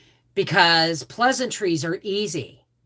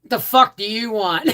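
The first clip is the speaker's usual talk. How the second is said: gruff sergeant; 4 semitones higher, 6 dB louder than usual. Retold or imitated. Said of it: "As Sam Elliott would say".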